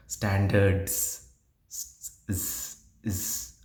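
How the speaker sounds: "Standards", then its final "z" hissed alone; stretched ending. Shown as a demonstration. Teacher showing how to stress endings, final z stretched. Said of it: "How I am stretching".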